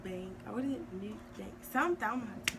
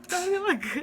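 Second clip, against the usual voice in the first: laughing; 10 semitones higher, 11 dB louder, slower than usual. Real, not acted. Laughing reaction to food.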